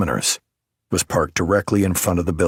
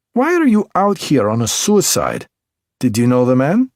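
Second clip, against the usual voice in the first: put-on higher, louder Belarusian-accented woman, sharp and teasing; 7 semitones higher, 5 dB louder than usual. A narrator's reading. M.E. technician Alina needles the detective in Belarusian accent.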